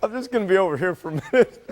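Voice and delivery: giggling through the words; trying not to laugh on air; anchor stifling giggles. Real, not acted.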